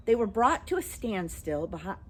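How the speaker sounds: usual speaking voice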